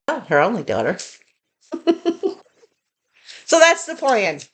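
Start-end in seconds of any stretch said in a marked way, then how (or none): 1.7-2.3 short laugh. Real, not acted. Joke about only daughter.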